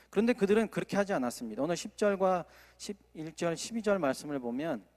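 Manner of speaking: normal talking voice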